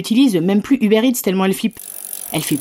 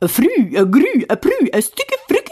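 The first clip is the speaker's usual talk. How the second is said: mock Swedish gibberish; 10 semitones higher than usual. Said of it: "j'aurais préféré parler suédois".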